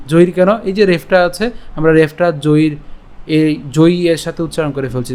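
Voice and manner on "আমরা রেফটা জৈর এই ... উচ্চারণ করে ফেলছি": ordinary talk, nothing marked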